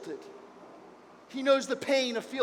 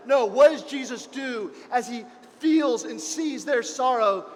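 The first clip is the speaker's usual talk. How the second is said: ordinary talk, nothing marked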